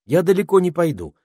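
put-on high, bright young girl; girl's voice. A narrator's reading. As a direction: promise it lightly as a girl. Penelope promises, high.

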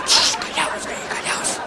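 susurro urgente; susúrralo escondido. Acted. Amante esconde en el closet. (susurro urgente en el closet)

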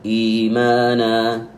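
إيمانا with the long i held two beats; slow recitation. Shown as a demonstration. Teacher pointing to the word, slow recitation. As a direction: chant إيمانا slowly, holding the long i. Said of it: "هذا مد بدل".

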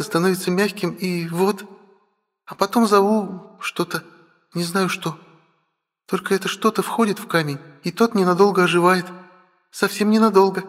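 put-on very high, girlish character voice; young dragon sister. Sister explains her stone magic to brother, high-pitched.

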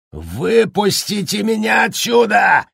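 high, slow furious shout; berserk rage. A narrator's reading. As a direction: roar like a trapped madman. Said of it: "в ярости орал Джек".

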